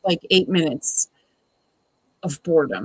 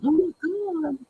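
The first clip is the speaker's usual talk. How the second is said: put-on much higher, swooning Southern Belle accent; 10 semitones higher than usual. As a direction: gasp it like a fainting Southern Belle. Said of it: "Southern Belle or something".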